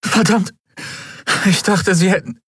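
high, breaking relief; relieved outburst. A narrator's reading. Endriel hugs Miko in tearful relief, high. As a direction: blurt it out while hugging him. Relieved embrace after near death.

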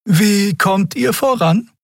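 drawn-out curious question; Xea asking. A narrator's reading. Xea asks Miko. (sehr hohe, langsame Stimme)

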